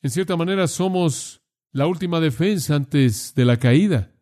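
ordinary talk

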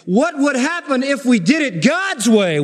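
high, emphatic pulpit challenge; impassioned preaching. Real, not acted. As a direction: challenge the congregation forcefully. Preaches at full force.

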